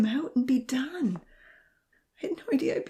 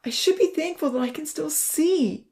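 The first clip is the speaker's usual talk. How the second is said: high, louder, upset voice on the edge of tears; tearful; 8 semitones higher, 6 dB louder than usual. A narrator's reading. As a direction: fight back tears while talking. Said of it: "choking on another sob".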